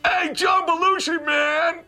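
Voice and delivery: high, slow, drawn-out stoner yell; stoner shout. Retold or imitated. Stoner kid spots John from his car, slow high shout. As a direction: call out like a starstruck stoner kid. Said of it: "real stoner, goes".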